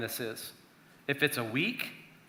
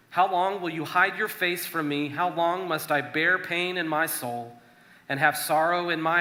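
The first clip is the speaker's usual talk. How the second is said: earnest, louder psalm reading; scripture reading; 3 semitones higher, 4 dB louder than usual. Acted. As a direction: read the lament like a plea to God. Reads psalm verses aloud.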